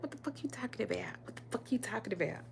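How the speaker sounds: fast, breathy whispered disbelief; rapid whispered outrage; incredulous whisper. Retold or imitated. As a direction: hiss it under your breath, fast and stunned. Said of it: "I was like".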